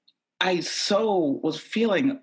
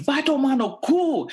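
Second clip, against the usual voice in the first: high, loud outburst in Portuguese; furious high-pitched cursing; angry yell; 12 semitones higher, 5 dB louder than usual. Retold or imitated. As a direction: yell the curse at the people who wronged you. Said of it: "yell at these motherfuckers".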